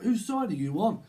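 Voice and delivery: hammed-up argumentative question; council estate argument. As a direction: demand loyalty in a put-on estate row. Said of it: "this council estate type argument".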